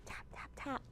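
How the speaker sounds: voiceless whispered tap, tap, tap; soft hushed taps like a distant woodpecker. Acted. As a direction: breathe the "tap" sounds with no voice. Woodpecker tapping sound imitated.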